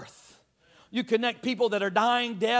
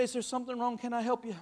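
soft, kind offer of help; softly asking a rude coworker if something is wrong; gentle kind question; 2 semitones higher, 7 dB quieter, faster than usual. Pretend. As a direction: ask softly like you truly care. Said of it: "just say".

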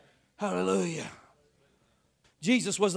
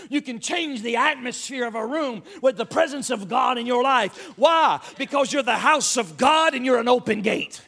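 ordinary talk

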